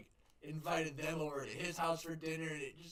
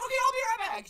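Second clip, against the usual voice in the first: high eager clerk; 6 dB louder, much faster than usual. Retold or imitated. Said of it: "She's like".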